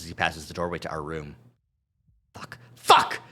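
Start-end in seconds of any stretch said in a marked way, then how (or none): 2.3-3.2 angry swearing. Acted. Duke swearing.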